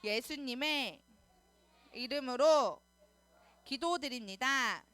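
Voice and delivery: slow chanted prayer in short phrases. Real, not acted. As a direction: lead the prayer phrase by phrase, stretching each ending. Leads children in repeat-after-me prayer.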